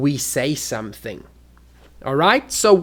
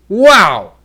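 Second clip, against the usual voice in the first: loud, higher amazed "Wow"; big surprise; 4 semitones higher, 10 dB louder than usual. Shown as a demonstration. Teacher showing volume changes meaning, big loud surprise. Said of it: "that is a big surprise".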